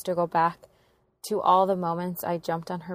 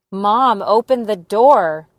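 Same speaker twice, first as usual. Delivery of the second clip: loud, drawn-out shout through a locked door; retold yell; 3 semitones higher, 14 dB louder, slower than usual. Daughter yelling at her mom behind the locked door, loud slow shout. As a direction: yell at her to open up, slowly and hard. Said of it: "I yelled".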